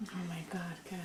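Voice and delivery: breathy whisper; hushed oh my god. Real, not acted. Whispered aside.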